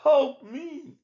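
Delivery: high squeaky cry for help; tiny squeaky mouse. A narrator's reading. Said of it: "ran home and cried".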